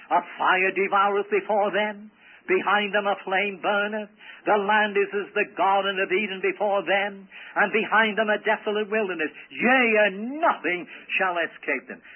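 sustained, level-pitched intoned reading; scripture declamation. Real, not acted. Preacher reading the prophet's vivid description, intoned scripture declamation. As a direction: intone each verse phrase firmly. Reads scripture aloud to congregation.

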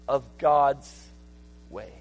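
high, loud, driving sermon delivery; full-force preaching. Real, not acted. Preacher retelling Lot's story to the congregation, full-force preaching. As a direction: preach it out loud and high, stretching the key words.

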